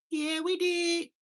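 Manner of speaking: put-on squeaky little kid asking for breakfast; high kid voice. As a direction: pitch way up like a small child. Kid answering parent.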